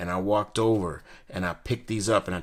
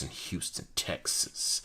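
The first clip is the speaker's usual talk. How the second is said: whispered aside; 4 semitones lower, 7 dB quieter, slower than usual. Real, not acted. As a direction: drop to a whisper on the punchline.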